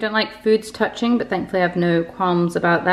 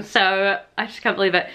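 ordinary talk

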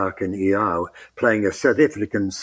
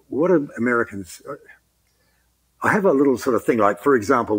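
normal talking voice

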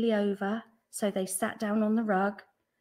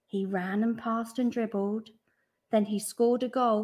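usual speaking voice

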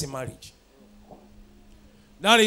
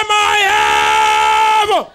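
high, loud and slow cry of my heaven; preacher leading a shouted response, loud and drawn out; shouted prayer; 11 semitones higher, 12 dB louder, much slower than usual. Shown as a demonstration. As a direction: call it out to the congregation.

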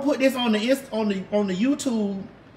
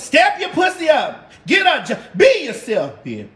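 signature catchphrase shout; 7 semitones higher, 5 dB louder than usual. Pretend. Giving her fans the famous catchphrase, high and loud.

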